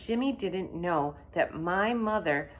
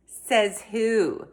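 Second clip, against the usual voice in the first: sassy; 5 semitones higher, 7 dB louder than usual. Acted. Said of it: "she asked".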